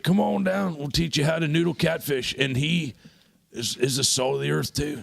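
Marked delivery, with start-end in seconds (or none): 0.0-2.3 hearty invitation. Retold or imitated. Uncle Jeff inviting the crew to noodle catfish, high and eager. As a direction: welcome them down south. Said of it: "he's like".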